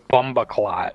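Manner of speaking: put-on Jamaican accent. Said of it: "you should say".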